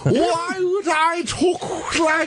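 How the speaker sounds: very high, slow, hammed-up aristocrat; old english. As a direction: shout it like a pompous old lord. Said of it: "I speak in old English".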